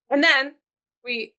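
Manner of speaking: put-on old-time radio announcer voice; 1940s announcer. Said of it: "like you're an announcer in the nineteen forties".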